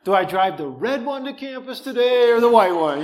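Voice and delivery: like you can't believe your luck, laughing it out; excited disbelief. Real, not acted. Gleeful retelling of two cars.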